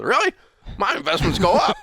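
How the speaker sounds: high incredulous "really", then laughing comeback; passive-aggressive Southern grandfather. Retold or imitated. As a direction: play the sly old grandpa, half laughing. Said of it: "cocked that head sideways ... and goes".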